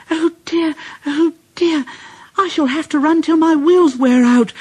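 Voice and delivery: high fretful engine voice; high anxious lament; worried Percy. A narrator's reading. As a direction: moan it like a weary engine. Tired runaway Percy.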